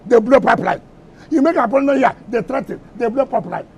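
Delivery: ordinary delivery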